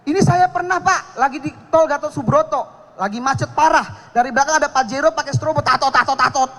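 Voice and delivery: ordinary talk, nothing marked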